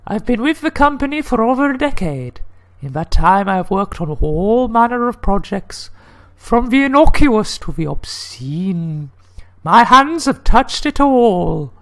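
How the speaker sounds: high, theatrical woman's voice; lady voice. Acted. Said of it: "I get to do the lady voice".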